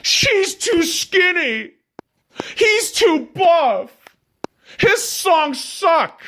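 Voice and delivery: high, slow, mock-crying whine; whiny victim voice. Podcaster mocks a whiny victim, high slow mock sobbing. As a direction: whimper like a self-pitying crybaby. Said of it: "that asshole with that victim mentality saying".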